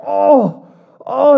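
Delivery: hammed-up hungry moan for attention; mock groan. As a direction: groan so everyone notices. Hypocrite groaning to be noticed.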